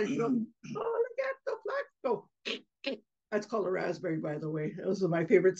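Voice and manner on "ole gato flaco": put-on very high, soft, slow little-girl taunt; tiny squeaky copycat tease; girl copying brother. Little sister copying her brother's taunt at the cat, teasing. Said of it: "copied her brother".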